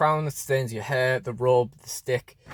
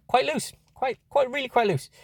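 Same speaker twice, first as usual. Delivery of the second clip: much higher, squeaky mock dismay over the joint; 12 semitones higher than usual. Real, not acted. Toy reviewer telling viewers the elbow is loose, in squeaky mock dismay. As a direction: pitch it way up in mock despair at the floppy elbow.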